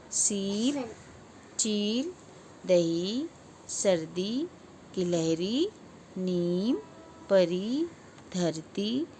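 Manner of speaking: each word from कली to वाणी with its ई held long; word drill stretching ee as in जीभ, नदी, नीम; long ee demonstration. Shown as a demonstration. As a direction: draw out every ई, as in कली and चील. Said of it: "इसका उच्चारण भी अलग हो रहा है".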